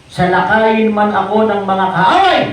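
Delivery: loud, declaimed Bible reading; forceful scripture proclamation. Acted. Preacher reading a psalm of fearless trust, loud and declamatory. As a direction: thunder the verse out to the congregation.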